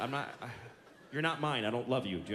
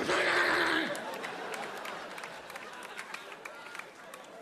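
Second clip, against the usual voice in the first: imitated kid meltdown; 9 semitones higher than usual. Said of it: "they go".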